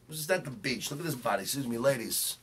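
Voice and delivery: ordinary talk, nothing marked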